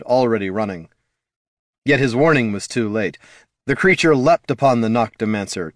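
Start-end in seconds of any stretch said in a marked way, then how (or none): none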